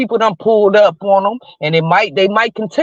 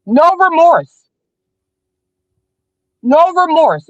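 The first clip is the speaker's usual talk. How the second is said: indignant; 6 semitones higher, slower than usual. Real, not acted. Condemning the parents for showing none, high and indignant. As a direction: hammer it home twice.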